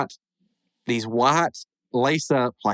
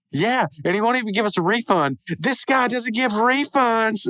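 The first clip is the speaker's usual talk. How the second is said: whiny tattling; 10 semitones higher than usual. Retold or imitated. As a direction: whine it up like a tattling kid. Rigby tattling to the line.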